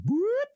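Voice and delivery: a high siren-like whoop from the cop car; mock siren whoop. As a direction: chirp it like a police siren. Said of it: "there's a cop and they go".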